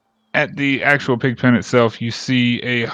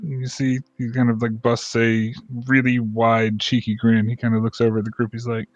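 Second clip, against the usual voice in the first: ordinary talk, nothing marked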